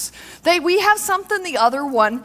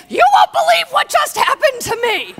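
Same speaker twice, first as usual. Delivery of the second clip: much higher, louder squeal of news; bursting with news to best girlfriend; excited shout; 12 semitones higher, 5 dB louder than usual. Pretend. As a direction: blurt it out to your best friend.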